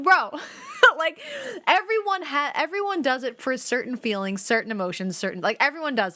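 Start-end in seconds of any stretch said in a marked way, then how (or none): 0.4-0.9 loud, very high squealing laugh; burst of laughter. Real, not acted. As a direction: let a shrieking laugh burst out. Laughing at boyfriend's habit.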